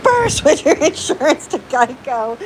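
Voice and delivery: slow put-on voice for the animal; mock animal voice. Owner voicing her animal quoting a Geico ad, put-on voice. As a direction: voice the animal as a silly commercial. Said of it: "He's like".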